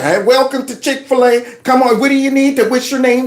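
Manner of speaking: hammed-up eager fast-food welcome; chirpy Chick-fil-A worker. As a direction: play the overly nice drive-thru worker. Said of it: "They are saying".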